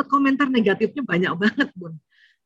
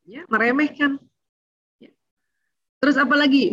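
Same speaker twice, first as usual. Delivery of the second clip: ordinary delivery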